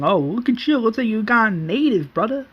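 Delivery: joking taunt. Retold or imitated. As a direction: needle a brother in mock jest. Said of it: "Then Merle jokes saying".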